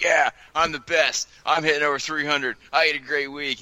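mock a cocky rookie bragging. Host contrasting bragging rookies with humble Benintendi, cocky mock brag. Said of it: "most guys who are rookies would be like".

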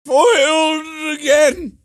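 put-on very high, strained, exasperated old man; slow, hammed-up doddering frustration; Joe Biden. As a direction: curse like a foiled cartoon old-timer. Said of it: "Joe Biden's gotta be like".

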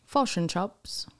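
slow New Zealand "fush and chups"; New Zealand accent. Shown as a demonstration. Host tries the demonstrated accent, slow New Zealand. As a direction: copy the New Zealand vowels slowly. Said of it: "And New Zealand is".

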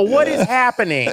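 loud, high hysterical wail, played up; mock crying. Exhausted assistant crying at the hotel, loud mock wail. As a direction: cry out in comic despair. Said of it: "And I started crying".

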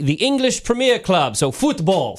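high-pitched put-on English accent; mock English accent. British soccer terms in mock English accent.

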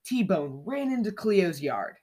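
plain reading voice

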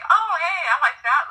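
high-pitched mock discovery; imitated eager copycat. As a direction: play someone casually spotting an idea to copy. Acts out a casual copycat.

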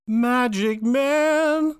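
loud, high, hugely drawn-out proclamation; grand character reveal. Acted. Co-host unveils the one and only, grand reveal. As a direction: belt out the name like a showy magician.

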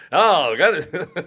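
ordinary talk, nothing marked

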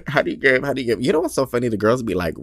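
normal talking voice

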